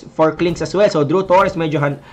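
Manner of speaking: ordinary talk, nothing marked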